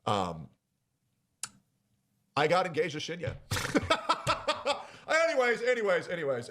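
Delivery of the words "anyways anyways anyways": high laugh then rushed anyways; embarrassed laugh. Real, not acted. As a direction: laugh off the awkward reveal. Embarrassed after reveal.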